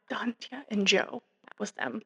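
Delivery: breathy whispered aside, nearly silent. Real, not acted. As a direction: drop to a soft secret whisper. Aside whispering names of couple.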